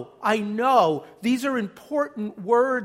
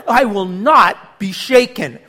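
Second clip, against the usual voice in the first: resolute declaration; 9 dB louder than usual. Shown as a demonstration. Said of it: "I, I just like".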